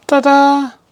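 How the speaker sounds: high, loud showman's ta-da; excited ta-da. Real, not acted. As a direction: present it like a magician. Presenting finished work.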